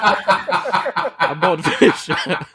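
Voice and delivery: slow, laughing through the words; real laughter breaking the words. Real, not acted. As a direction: fight through the giggles to finish. Laughing through own line.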